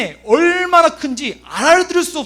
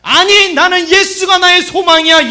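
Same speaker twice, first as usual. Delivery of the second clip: loud, high cry of conviction; impassioned full-force proclamation; defiant declaration; 8 semitones higher, 8 dB louder than usual. Acted. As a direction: shout back in firm refusal. Defiant answer to rebuking voices.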